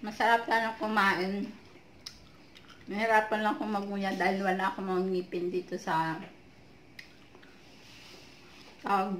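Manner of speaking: words between bites; talking while chewing. Real, not acted. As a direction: mouth partly full of fruit. Seen 2: Mango put in mouth earlier; cheeks bulge, lips pressed mid-speech.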